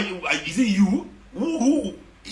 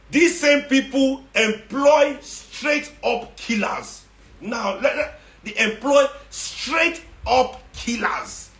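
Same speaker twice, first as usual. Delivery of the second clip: slow emphatic accusation; 4 semitones higher, much slower than usual. Real, not acted.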